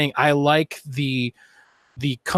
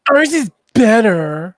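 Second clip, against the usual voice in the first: mock boast; 8 semitones higher, 8 dB louder, slower than usual. Mocking the console maker's boast.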